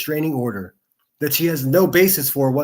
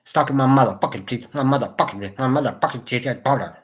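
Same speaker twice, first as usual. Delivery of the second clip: mocking tough girl voice. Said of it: "Why do you talk like that?".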